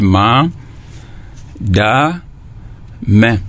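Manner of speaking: "ma", "da", "me", each held and set apart; syllable by syllable. Shown as a demonstration. Teacher showing pupils three parts, syllable by syllable. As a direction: dictate "ma", "da", "me" as three separate pieces. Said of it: "J'ai lu ces mots en trois parties".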